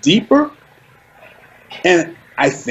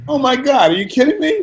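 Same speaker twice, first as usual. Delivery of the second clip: mock disbelief; 8 semitones higher than usual. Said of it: "I'm like".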